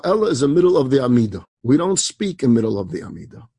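usual speaking voice

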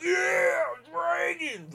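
very high, drawn-out mock whoop; ridiculous hype-man yell. Host mimics guy cheering dragons at presentation, high yelling. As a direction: imitate an over-the-top fan cheering from the back. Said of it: "he was just ridiculous".

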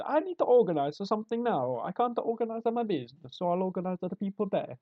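high, loud mock Italian; hammed-up comic Italian gangster; Italian accent. Italian kidnapper explaining himself, mock Italian accent. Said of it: "why are they so good at organised crime".

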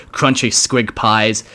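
usual speaking voice

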